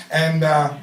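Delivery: ordinary talk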